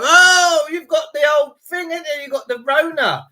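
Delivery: high, put-on voice of a ribbing mate; lightly voiced teasing friend; mock teasing mates. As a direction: send up your mates' knowing dig. Mates teasing him about covid.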